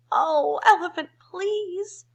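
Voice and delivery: high, loud "please"; mock crying out. Birds in the trees beg the elephant, loud high plea. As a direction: birds begging loudly. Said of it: "cried the birds in the trees".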